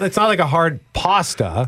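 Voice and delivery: ordinary delivery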